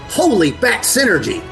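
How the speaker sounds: usual speaking voice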